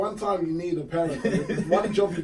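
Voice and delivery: laughing rant. Real, not acted. Host laughing while ranting about a dad on his phone, laughing. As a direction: laugh as the outrage builds.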